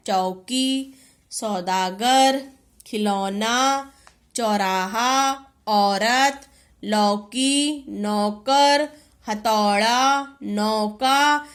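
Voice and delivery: each word from चौकी to नौका drawn out low; slow, separate teacher's reading, चौकी to नौका; sounded-out words. Shown as a demonstration. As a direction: stretch every औ from चौकी through नौका. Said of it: "उसका शब्द बोलना है".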